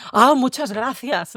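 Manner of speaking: agradecimiento fingido, alto y alargado; da las gracias con sorna, alargando; gracias irónicas. Acted. Responde con ironía al consejo. (agradecimiento irónico)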